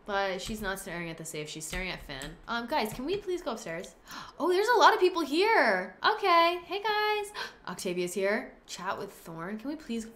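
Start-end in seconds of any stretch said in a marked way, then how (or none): 4.4-7.3 gasp, then a high, louder greeting; sudden delight at the crowd; surprised gasp. Real, not acted. Host spots a room full of party guests, high surprised greeting.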